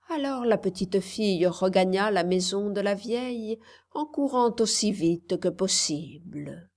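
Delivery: ordinary delivery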